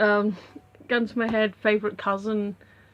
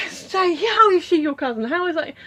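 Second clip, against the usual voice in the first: imitating a high, louder questioner; mock incredulous, pitched well up; coworker's nosy question; 8 semitones higher, 6 dB louder than usual.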